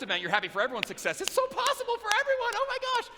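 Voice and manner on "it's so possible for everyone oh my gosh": much higher, squealing excitement; mock gushing. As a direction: gush like a starry-eyed newbie. Mocking gushing first-timer.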